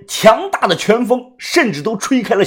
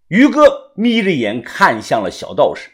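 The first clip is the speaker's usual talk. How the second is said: usual speaking voice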